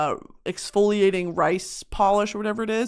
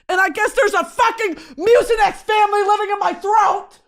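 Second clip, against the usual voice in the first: exasperated outburst; 11 semitones higher, 5 dB louder than usual. Real, not acted. Annoyed at cough.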